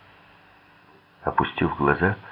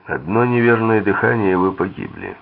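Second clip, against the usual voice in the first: loud, raised warning that drops at the end; stern warning; 4 semitones higher, 7 dB louder than usual. A narrator's reading. Barrett warns his captive while putting the coat on him, stern warning. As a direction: warn forcefully, pitched up, then fall away. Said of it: "предупредил меня Барретт".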